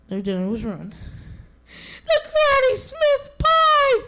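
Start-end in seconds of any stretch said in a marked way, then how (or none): 2.1-4.0 very high, slow wailing exclamation; exclaiming mother wolf. Acted. Mother wolf finding her dinner ruined, high wailing exclamation. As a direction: shriek it out like a dismayed mother. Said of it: "exclaimed Mother Wolf".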